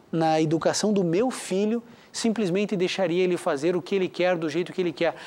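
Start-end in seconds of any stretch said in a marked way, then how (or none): none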